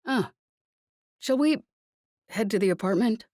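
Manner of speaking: jumps up high on shall we, awkward and hurried; an embarrassed official trying to change the subject; flustered official. A narrator's reading. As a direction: official squirming after Jez's suggestive joke, voice jumping up. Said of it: "cleared his throat uncomfortably".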